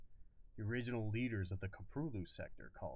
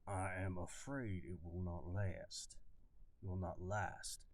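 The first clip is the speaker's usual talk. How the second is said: reworked slow Southern drawl; slow Southern colonel drawl; slower southern accent; 4 dB quieter, slower than usual. Acted. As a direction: drag the words out like an old Southern officer. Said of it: "Let me figure out how to say it".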